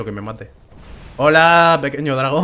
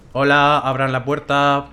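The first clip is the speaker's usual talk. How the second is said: ordinary talk, nothing marked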